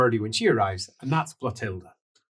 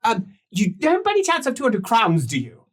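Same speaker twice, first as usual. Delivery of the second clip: Lucas voice; 8 semitones higher than usual. Acted. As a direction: whine it out as a put-upon captain. Lucas begs the party.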